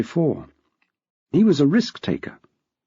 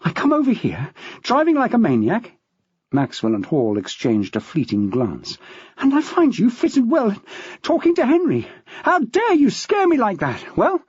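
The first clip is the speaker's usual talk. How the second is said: breathless angry scolding; 9 semitones higher than usual. A narrator's reading. Jackie, out of breath, scolding her husband in front of police. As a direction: let her fury spill out between breaths. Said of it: "she snapped as best she could when so out of breath".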